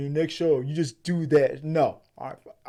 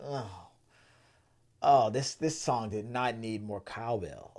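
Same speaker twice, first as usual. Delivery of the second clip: weary muttered complaint; 3 semitones lower, 8 dB quieter, slower than usual. Retold or imitated. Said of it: "You're like".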